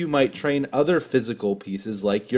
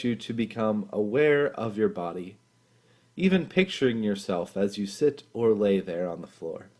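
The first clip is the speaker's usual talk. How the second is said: guided meditation; 2 semitones lower, 5 dB quieter than usual. Acted. Leader guiding listeners through a body scan, calm and soft. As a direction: ease them gently into stillness, unhurried and calm.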